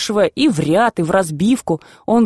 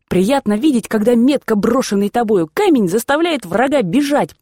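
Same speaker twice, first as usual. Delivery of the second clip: plain reading voice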